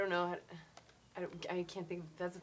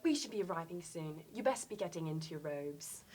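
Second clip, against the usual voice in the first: a hint of prim Hermione, a bit softer; lightly voiced bossy schoolgirl; understated Hermione telling them to get their robes on; Hermione impression; 4 semitones lower, 7 dB quieter than usual. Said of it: "She's like".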